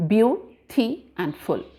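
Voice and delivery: BEAUTY AND FULL slowly as byoo, tee, and full; split into syllables. Shown as a demonstration. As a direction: sound out each of the three units with gaps. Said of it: "there are three syllables in this".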